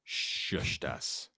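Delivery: ordinary talk, nothing marked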